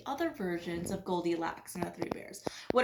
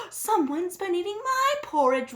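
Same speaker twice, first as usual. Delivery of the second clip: higher, louder mama-bear wail; 8 semitones higher, 6 dB louder than usual. A narrator's reading. Mama Bear finds porridge eaten, higher wail. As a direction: wail it as the upset mother. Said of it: "wailed Mama Bear".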